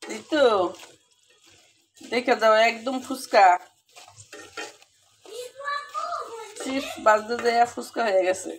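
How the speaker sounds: thrilled call to come see; excited calling. Real, not acted. Calling kids to see the chips.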